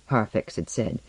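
usual speaking voice

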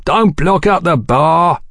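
slow warning shout across the bar; barman calling out. A narrator's reading. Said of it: "cried the barman".

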